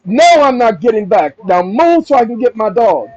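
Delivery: loud, defiant shout of refusal. Pretend. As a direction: yell it back, not budging. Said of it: "That's my response".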